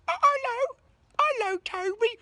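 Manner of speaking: very high, squeaky English puppet voice; English bunny voice. Pretend. Vlogger voicing a shadow puppet bunny, very high squeaky English voice. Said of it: "why he's English".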